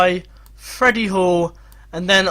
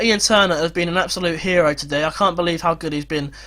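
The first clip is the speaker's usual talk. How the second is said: ordinary talk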